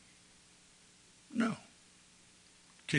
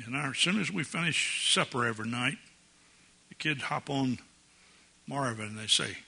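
ordinary talk, nothing marked

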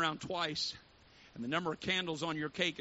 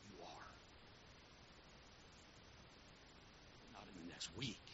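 much softer, intimate questions; hushed appeal; 12 dB quieter than usual. Acted. Preacher quietly asking the congregation how they would live, hushed. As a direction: draw them in close and quiet.

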